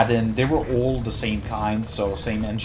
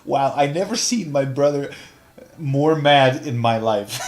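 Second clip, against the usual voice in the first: laughing; 3 semitones higher, 5 dB louder than usual. Real, not acted. Laughing while recalling brother's anger.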